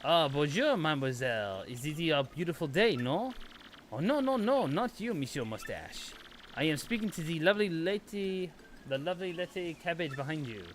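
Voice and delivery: hammed-up French flirt; mock French accent. A French flirt wooing the lady, in a mock French accent. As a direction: purr like a cheesy French charmer.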